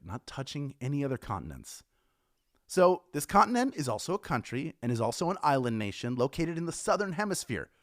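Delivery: normal talking voice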